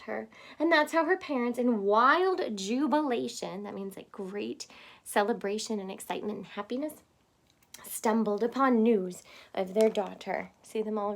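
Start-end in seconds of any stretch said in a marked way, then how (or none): none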